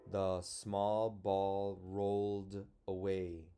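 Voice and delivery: put-on slow, even reading for children; slow reading aloud. Shown as a demonstration. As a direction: read it slowly together with the class. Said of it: "We'll read it together".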